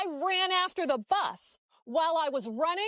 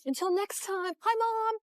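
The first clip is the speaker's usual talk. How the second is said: high-pitched, playful sign-off; excited on-air greeting; 7 semitones higher than usual. Acted. Interviewee saying hi to Mom on the news, excited high voice.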